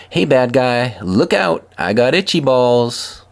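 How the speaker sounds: put-on kid superhero yell. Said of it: "when Aiden yelled".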